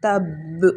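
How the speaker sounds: تب ending in a bounced ب; تب with qalqala on ب; stop with qalqala. Shown as a demonstration. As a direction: let the ب of تب echo at the stop. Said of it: "آگے وقف".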